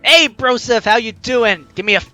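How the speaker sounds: ordinary talk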